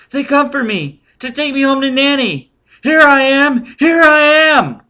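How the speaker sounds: put-on very high, loud child voice, building; excited child. A narrator's reading. Boy hailing soldiers to take him home, joyful shriek. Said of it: "Sigurd's crumpled face brightened".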